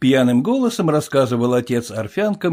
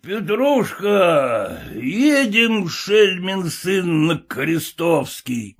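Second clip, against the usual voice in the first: put-on drunken shout; 6 semitones higher, much slower than usual. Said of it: "кричал отец".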